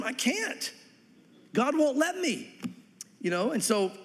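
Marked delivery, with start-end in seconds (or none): 0.0-2.5 high, slow helpless protest; refusing, with hands tied; whiny refusal. Acted. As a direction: plead that you cannot do it. Balaam refusing, acted back-and-forth.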